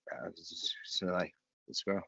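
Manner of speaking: trailing, garbled mock mumble; mumbling. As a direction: imitate a soft, incoherent mumble. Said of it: "I just kept mumbling".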